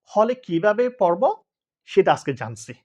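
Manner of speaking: ordinary talk, nothing marked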